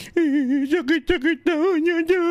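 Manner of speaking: put-on high, whining child's complaint; mock child whimper about the pain; whiny crying child. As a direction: play the kid crying about the shot. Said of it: "umiiyak daw yung bata".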